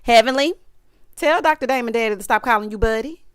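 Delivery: ordinary talk, nothing marked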